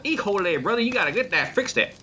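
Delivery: high, hammed-up country accent mixing Spanish and English. Imitating the guy who walked in, country accent. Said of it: "he spoke Spanish with a country accent".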